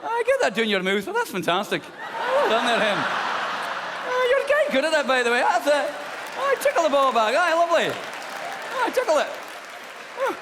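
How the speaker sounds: put-on high, loud polite Scottish accent; a prim Scottish man cheering on gently; genteel Scottish porn. A polite Scottish porn actor praising his partner, loud high Scottish accent. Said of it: "image of Scottish porn".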